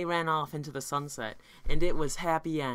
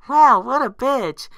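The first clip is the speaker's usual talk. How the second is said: loud high outraged squeal; 8 semitones higher, 13 dB louder than usual. Acted. Fluttershy quote in the fanfic.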